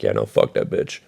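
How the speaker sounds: low, soft dismissive mutter; dismissive mumble. Retold or imitated. Cole skipping detention, dismissive mutter. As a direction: shrug it off like Cole.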